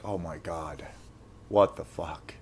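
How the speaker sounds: hushed, deflated mutter; stunned disbelief. Retold or imitated. Standing in the empty lobby, a hushed stunned mutter. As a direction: barely breathe it out, gutted.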